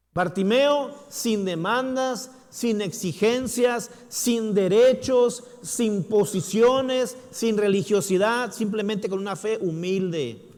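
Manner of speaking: loud, high, slow listing; preaching crescendo. Acted. Preacher building the list to the congregation. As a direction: hammer each "sin" home.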